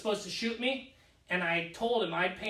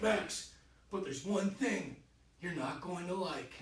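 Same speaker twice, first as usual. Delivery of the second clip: hushed warning; 3 semitones lower, 7 dB quieter than usual. Retold or imitated. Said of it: "He's like".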